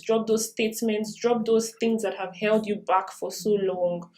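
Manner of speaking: usual speaking voice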